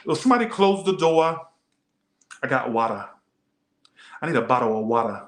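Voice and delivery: lightly voiced New Jersey accent; a touch of New Jersey talk; mild imitation of the New Jersey accent. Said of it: "This is how they talk".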